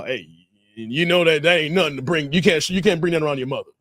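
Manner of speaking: low-pitched imitation of a father's warning; imitating his dad. Dad warns his son about a woman, imitated low. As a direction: drop the voice into a mock fatherly caution. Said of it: "my dad would know".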